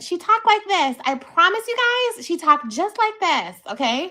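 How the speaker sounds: very high, loud put-on voice; exaggerated high-pitched impression of someone; imitating her talk. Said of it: "That was the way she talked".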